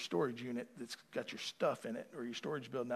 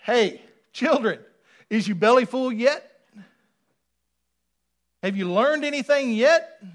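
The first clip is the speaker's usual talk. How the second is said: higher, loud, chiding call; paraphrased Jesus; 10 semitones higher, 15 dB louder than usual. Retold or imitated. Preacher voicing Jesus calling from the shore, loud and chiding. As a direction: scold the disciples like a parent. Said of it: "he's basically saying".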